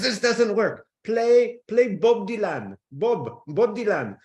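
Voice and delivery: a Frenchman losing patience with Siri; angry Frenchman. Retold or imitated. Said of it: "he would get so angry".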